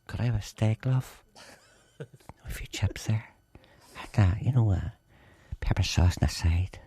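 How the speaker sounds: low, hushed mumble; low-key ordering voice. Acted. Announcer ordering in a restaurant, in a low everyday mumble. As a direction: drop the showmanship and murmur the order. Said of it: "This is how I order in a restaurant".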